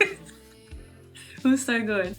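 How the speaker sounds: usual speaking voice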